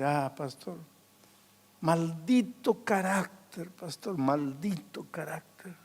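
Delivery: soft, slow, grieving lament; weeping old man. Retold or imitated. Old man weeping to the pastor, cursing his temper softly. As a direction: curse your temper through tears. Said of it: "comenzó a llorar el viejito".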